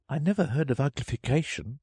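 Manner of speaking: put-on high, tentative girl's voice; Alice venturing. A narrator's reading. Alice daring to question an odd word, high and tentative. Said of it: "Alice ventured to say".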